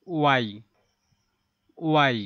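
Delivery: loud ওয়াই said as a teacher models it; ওয়াই, loud and clear; model letter name. Shown as a demonstration. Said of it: "teacher modelling letters". (জোরে ওয়াই উচ্চারণ)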